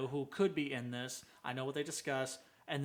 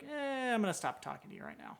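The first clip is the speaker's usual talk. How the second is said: mock reluctance; 8 semitones higher, 4 dB quieter than usual. Backing out of chat with a prying stranger, mock reluctance. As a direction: balk in a much higher voice. Acting out backing away from questioner.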